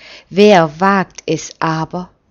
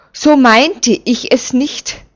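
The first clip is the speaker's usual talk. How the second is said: higher, louder whimpering plea; whining; 6 semitones higher, 5 dB louder than usual. A narrator's reading. Usiel begs Simeon to let go, whining plea. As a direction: whine to be let off. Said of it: "jammerte Usiel".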